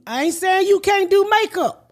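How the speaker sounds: high, pushed-up retort to the makeup artist. Retold or imitated. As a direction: put her in her place, voice climbing.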